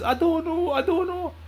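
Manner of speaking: high, whiny crying imitation of the girlfriend; mimicking her crying. Teller imitating girlfriend's tearful high-pitched answer. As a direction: squeak it out like her tearful reply.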